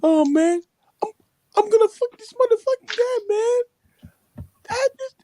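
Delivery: high, whiny mock sobbing rant; crying man. Retelling a tearful man's threat to his friend, mock crying. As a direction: imitate a man raging through tears.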